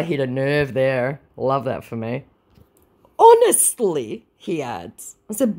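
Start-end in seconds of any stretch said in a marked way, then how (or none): 3.2-4.3 high, loud, drawn-out protest; hammed-up insistence; pleading scammer. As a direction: protest slowly in a put-on high voice. Voices scammer's message.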